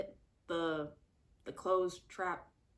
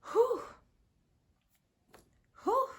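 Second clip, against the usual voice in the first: relieved whew; 8 dB louder than usual. Real, not acted. Exhaling after the story.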